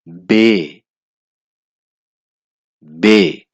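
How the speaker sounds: बे, said loud and clear like bay; German B as bay. Shown as a demonstration. Reading the alphabet to learners, loud clear letter name. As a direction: call out बे, the German B, loudly. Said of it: "German letter B".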